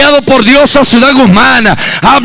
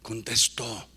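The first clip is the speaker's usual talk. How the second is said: low, soft, near whisper; hushed close; 11 semitones lower, 19 dB quieter than usual. Acted. Preacher closes softly, almost whispering to the church. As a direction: breathe the words to them.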